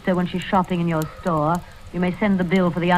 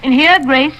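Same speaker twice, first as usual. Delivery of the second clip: raised voice calling to the next room; 6 semitones higher, 5 dB louder than usual. Acted. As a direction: call her in from the other room. Calling to sister in another room.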